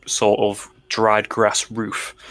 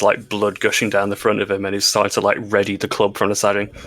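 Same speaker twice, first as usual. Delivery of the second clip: ordinary talk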